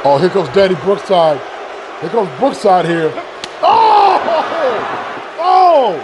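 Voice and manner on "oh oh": sharp high cry of surprise; shocked gasp. Real, not acted. Commentator reacting to a forearm hit, shocked high cry. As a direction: jolt upward as the blow lands.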